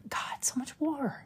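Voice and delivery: breathy whisper, slow and drawn out; whispered aside. Real, not acted. Whispering to a friend about too much war. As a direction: mutter it under your breath.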